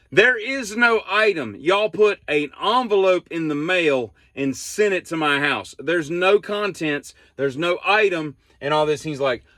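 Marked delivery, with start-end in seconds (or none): 0.1-8.4 high-pitched, slowed emphasis on the key phrases; retold fed-up customer spelling it out; exasperated insistence. Customer arguing with Henry over an empty envelope, exasperated insistence. Retold argument with call agent.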